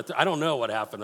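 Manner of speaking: ordinary talk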